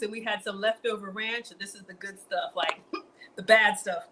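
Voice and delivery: ordinary talk, nothing marked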